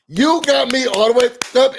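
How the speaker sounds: indignant exclamation. Real, not acted. Host reacting to the peasant insult, indignant. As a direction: punch it out indignant. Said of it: "second take, louder".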